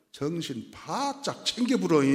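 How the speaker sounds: lilting Jeolla dialect, pitch swooping up; put-on Jeolla accent. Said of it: "전라도 말로".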